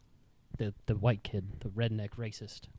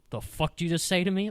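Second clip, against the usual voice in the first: fast, higher, louder retort; angry challenge; 5 semitones higher, 5 dB louder, faster than usual. Retold or imitated. Teacher rounds on the kid who talked back, angry challenge. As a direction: fire it back as an angry confrontation.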